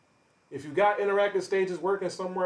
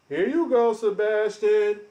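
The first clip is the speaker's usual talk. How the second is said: higher, louder, slow drawn-out taunt; 6 semitones higher, 6 dB louder, slower than usual. Real, not acted. Streamer loudly calling out his lying rival, taunting. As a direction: stretch the name out to mock him.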